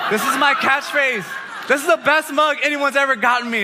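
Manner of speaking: overjoyed exclamation; excited shout. Real, not acted. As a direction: shout it out thrilled. Thrilled over a gift mug.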